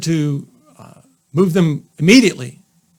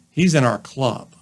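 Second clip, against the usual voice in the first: ordinary delivery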